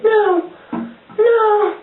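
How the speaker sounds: very high squeaky mock "no"; hammed-up falsetto wail; mock protest. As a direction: imitate a helpless hero in tiny falsetto. Mimicking Harry Potter's reaction to attack.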